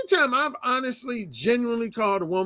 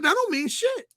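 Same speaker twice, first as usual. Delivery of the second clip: imitated dismissive retort, pitched up high; dead serious; 11 semitones higher than usual. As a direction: voice her flat-out denial in earnest, higher. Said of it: "she was dead serious".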